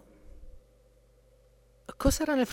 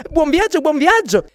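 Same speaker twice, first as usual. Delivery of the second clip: cheery farewell; 14 semitones higher, 10 dB louder than usual. Acted. Third lady bids them farewell.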